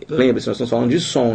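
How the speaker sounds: ordinary talk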